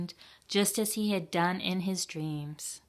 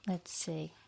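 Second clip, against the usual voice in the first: suspense whisper; 9 dB quieter than usual. Acted. Reader builds suspense for children.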